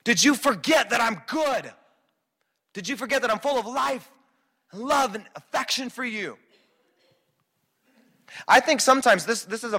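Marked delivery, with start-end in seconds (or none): 0.0-6.4 pleading Jesus. Retold or imitated. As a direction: remind him who you are. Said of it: "Jesus is like".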